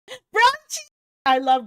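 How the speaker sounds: high delighted shout of a name; thrilled cry of recognition; excited squeal. Real, not acted.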